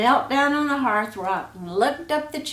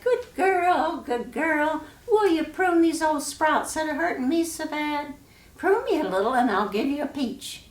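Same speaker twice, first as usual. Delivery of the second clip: high pleading peach tree voice, quicker at the end; a suffering tree that begs for help; 6 semitones higher than usual. Acted. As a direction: beg in a high voice to be pruned.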